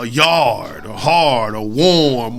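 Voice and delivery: slow Western New York twang on "yard", "hard", "warm", the A R drawn out. Shown as a demonstration. Radio host imitating Buffalo and Niagara Falls accent, slow and twangy. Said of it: "they have a certain twang in their accent".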